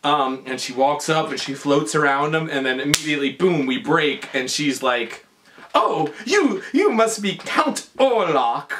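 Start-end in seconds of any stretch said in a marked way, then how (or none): 5.8-8.7 high, drawn-out put-on greeting; hammed-up imitation of a stilted actress; Lily. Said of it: "she's, like, talking like Ed Wood".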